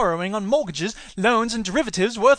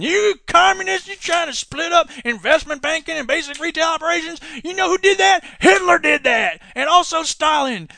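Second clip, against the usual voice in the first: mock outraged patriot; 7 semitones higher than usual. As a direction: bellow like a paranoid flag-waver. Sarcastic patriot ranting.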